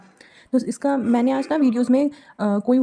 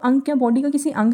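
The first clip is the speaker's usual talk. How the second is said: ordinary talk, nothing marked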